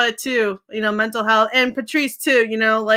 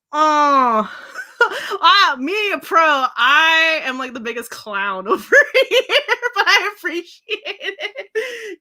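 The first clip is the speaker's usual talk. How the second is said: laughing; 7 semitones higher, slower than usual. Real, not acted. Laughing at herself as a clown.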